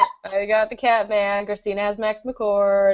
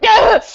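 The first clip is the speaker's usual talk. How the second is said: short, high, loud squeal; excited squeal; 13 semitones higher, 8 dB louder than usual. Retold or imitated. Reader recalls hearing him call her darling, excited squeal. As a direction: burst out with a fangirl shriek. Acts own reaction to the word.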